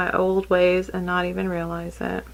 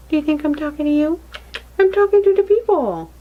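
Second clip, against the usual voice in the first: much higher and louder, mock-indignant; playful teasing; 6 semitones higher, 4 dB louder, faster than usual. Real, not acted. Mother teasing her daughter that she speaks to the viewers, high and playful.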